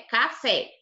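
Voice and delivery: ordinary talk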